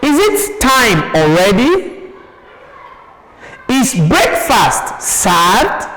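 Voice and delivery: questions climbing at "already" and "served"; rising tone. Shown as a demonstration. Teacher gives pupils yes-or-no examples, rising tone. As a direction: lift the pitch on the last word, "already", "served". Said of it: "rising tone".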